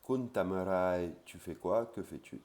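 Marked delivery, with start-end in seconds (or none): 0.0-1.1 "Kun tamarai" said slowly, each syllable clear; slow teacher's demo; slow Thai demo. Shown as a demonstration. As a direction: model "Kun tamarai" carefully for learners. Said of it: "Kun, pronom".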